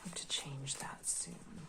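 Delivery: slow soft whisper aside. Real, not acted. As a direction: breathe it under your breath. Aside while shuffling cards.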